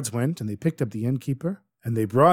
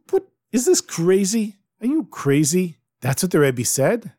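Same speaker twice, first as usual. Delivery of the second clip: incredulous scoff; 6 semitones higher than usual. Acted. Husband scoffs at leech remedy.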